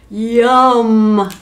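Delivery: a huge hungry bellow; giantess bellowing. Acted. Giantess grabbing the cow, bellowing with hunger. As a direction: boom it out like a greedy giantess. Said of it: "the giantess bellowed".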